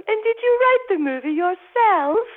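very high, loud, teary imitation of a queen. Retelling the Queen's question, choked up and very high. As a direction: quote her through tears and laughter. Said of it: "puts her hand out and goes".

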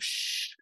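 unvoiced "shh"; soft imitated shushing noise; retold shush. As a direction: hush a visitor quietly. Man on phone shushes visitor.

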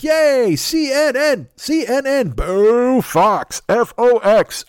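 loud, high sports-fan chant; mock cheer. Comic mimics cable-news fans cheering like football: loud chant. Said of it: "they're like".

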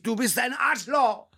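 high, slow mock Swedish accent. Quoting the Swedish friend after the crash, mock Swedish accent. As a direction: insult him in a hammed-up Swedish accent. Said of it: "In Schwedisch".

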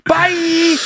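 high, bright goodbye; chirpy mock sign-off. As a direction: sing out a cheery farewell, pitched high. Sign-off as dumb daughter.

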